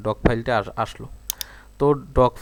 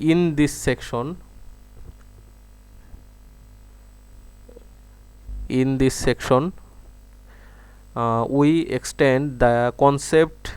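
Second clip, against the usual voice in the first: slow dictation; much slower than usual. Shown as a demonstration. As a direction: read the English out slowly for voice typing. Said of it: "একটু আস্তে আস্তে বলতে হবে". (ধীরে ধীরে, শব্দ ধরে ধরে ডিক্টেশন)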